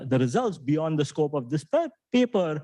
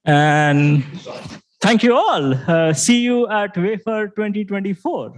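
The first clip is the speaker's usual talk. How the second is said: loud, slow sign-off to the room; 7 semitones higher, 8 dB louder, slower than usual. Real, not acted. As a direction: call it out over the crowd. Closing call-out to whole room.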